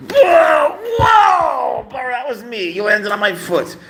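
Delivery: high, startled cry of pain, then protest; real surprised yelp, calming into a complaint. Real, not acted. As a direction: yelp as the cat lands on you. Cat lands on his foot.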